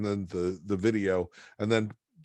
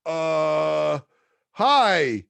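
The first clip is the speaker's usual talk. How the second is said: higher, hesitant caller caught off guard; flustered young caller, pitched up; nervous caller; 7 semitones higher, 4 dB louder, slower than usual. Retold or imitated. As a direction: stammer like you never expected an answer. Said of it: "And I'm like".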